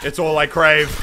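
normal talking voice

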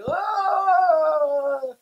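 a high, drawn-out let-down "Oh."; deflated realisation; dismayed oh. Retold or imitated. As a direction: sound the penny dropping with disappointment. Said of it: "And we were like".

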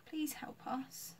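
timid plea for help; pleading child. A narrator's reading. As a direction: plead softly like a lost little kid. Pleading hungry child in a story.

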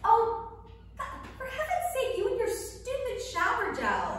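high, loud fed-up groan of complaint; exasperated outburst. Acted. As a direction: throw your hands up at her stupid shower gel.

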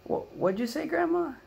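high, loud, baffled asking again; confused question. Retold or imitated. Grandson asking grandma to repeat, baffled and high. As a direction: act puzzled, pitch way up.

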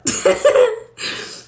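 loud, airy cackle of amusement; high laugh. Real, not acted. Amused at sounding really smart, high laugh. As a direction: burst out laughing.

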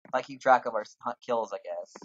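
usual speaking voice

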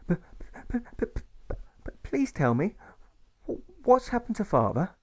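put-on a pleading little boy; stuttering boy. A narrator's reading. Alfred begs his mother for news, stammering.

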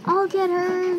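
half-hearted storybook scream. A narrator's reading. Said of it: "screamed Emma".

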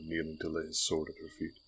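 usual speaking voice

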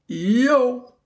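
a high, drawn-out cool-guy "Yo.". Retold or imitated. Podcaster quoting Lance's cool-guy farewell, mock cool. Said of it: "and I quote".

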